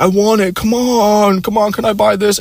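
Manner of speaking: high-pitched begging girlfriend impression; mock pleading. Girlfriend begging him to buy at the mall, mock pleading.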